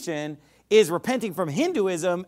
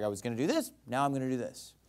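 offhand, no sorrow; 5 semitones lower, 7 dB quieter, faster than usual. Retold or imitated. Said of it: "I might not have any sorrow and just be like".

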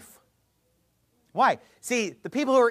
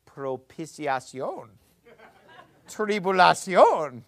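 propiciación and tribulación slow, in a Spanish accent; slower than usual. Shown as a demonstration. Teacher shows class how to fake Spanish words, Spanish accent. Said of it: "make it sound Spanish".

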